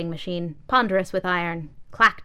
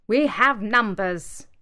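umbrella boasting; 3 semitones higher, 6 dB louder, much slower than usual. Acted. Broken umbrella speaks up.

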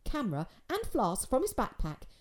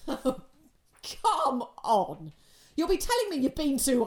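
laughing disbelief; 6 semitones higher, slower than usual. A narrator's reading. Father laughs off son's claim.

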